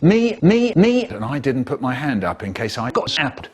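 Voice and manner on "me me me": high, louder chant of me; eager kids. Retold or imitated. Class begging teacher for food, high and eager. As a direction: beg to be picked. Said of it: "put up their hands and said".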